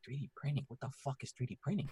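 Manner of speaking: hushed retold confusion; baffled aside. Retold bafflement at an unknown term.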